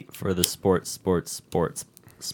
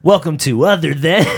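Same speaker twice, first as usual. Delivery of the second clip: higher, loud intro breaking into laughter; announcer cracking up; 4 semitones higher, 7 dB louder than usual. Shown as a demonstration. Co-host retrying the intro, loud and breaking into laughs. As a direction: boom it out, then crack up.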